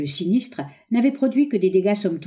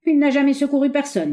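high, louder indignant protest; indignant reproach; 9 semitones higher, 5 dB louder than usual. Acted. Another woodcutter condemning the old miser, indignant. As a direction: call out the accusation with scorn. Woodcutters angrily refuse to help.

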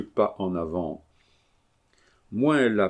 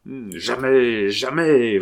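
louder, higher, slow drawn-out refusal; bellowed; 4 semitones higher, 6 dB louder, slower than usual. A narrator's reading. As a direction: roar out the refusal. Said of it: "vociféra l'assassin".

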